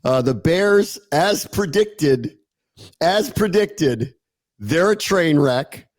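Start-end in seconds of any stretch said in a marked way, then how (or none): 1.1-4.1 slow repeat. Acted. Mock-solemn repeated phrase.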